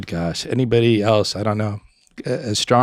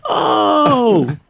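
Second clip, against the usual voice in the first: long, high, loud "oh!"; mock howl of horror; horrified group cry; 13 semitones higher, 7 dB louder than usual. Friends seeing the catheter go in, horrified cry.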